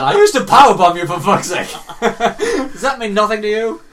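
mock outraged. Host scolding co-host for lying about listening, mock outrage. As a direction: rant in fake betrayal.

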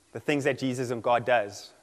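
normal talking voice

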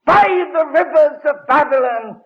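high, loud proclaimed verse; ringing psalm recital. Acted. Preacher proclaiming the psalm to his listeners, high and loud. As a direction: declaim the psalm to the hall.

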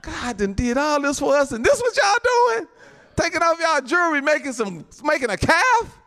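snapped, outraged Moses. Acted. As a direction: rant in disbelief at their betrayal.

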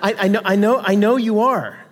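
ordinary talk

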